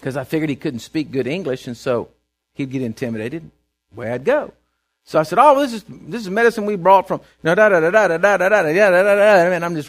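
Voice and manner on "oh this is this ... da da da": fast Texas drawl. Retold or imitated. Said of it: "talk as fast as you can with as big a Texas draw".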